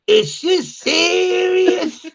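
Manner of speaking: very high, slow, stretched SERIOUS; hammed-up imitation of street disbelief; mock incredulous slang. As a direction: drag it out high, half laughing. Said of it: "and he's like".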